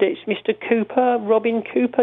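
high, slow, prim greeting; Robin Cooper voice. Retold or imitated. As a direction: sound genteel and overly polite. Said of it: "he had a certain voice".

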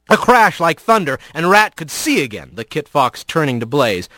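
ordinary delivery, nothing marked

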